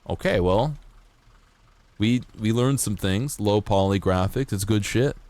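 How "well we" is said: ordinary talk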